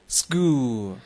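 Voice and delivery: "school" with s running straight into k; s-k linked. Shown as a demonstration. Teacher showing students the linked sound, s-k run together in "school". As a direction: link the s and k in "school". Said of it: "s和k之间的连读".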